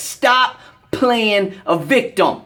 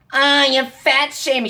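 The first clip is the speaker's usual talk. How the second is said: a sneering put-on whine, pitched higher; mocking whiny critic; 4 semitones higher than usual. Fitness ranter mocking critics who cry fat shaming, sneering whine. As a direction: mimic the complainers to ridicule them.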